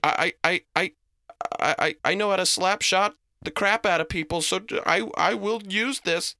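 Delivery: high, loud, stammering warning; panicked threat. A narrator's reading. Reese brandishing a hockey stick at the creature, panicked. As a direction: ward off an intruder in a stuttering panic.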